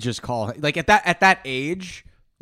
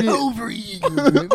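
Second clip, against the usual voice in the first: slow, high, drawn-out villain voice; 9 semitones higher, much slower than usual. Retold or imitated. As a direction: stretch the word out like a cartoon villain. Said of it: "Why'd you say it like a fuck".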